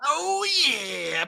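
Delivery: high, drawn-out radio whoop; over-the-top zoo-crew cheer; morning zoo DJ. Acted. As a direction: stretch it into a zany hype cry. Parodying morning zoo radio hype.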